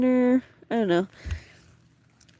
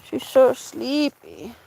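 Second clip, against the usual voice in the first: drawn-out coo; 4 semitones higher, 4 dB louder, much slower than usual. Real, not acted. Cooing about dog.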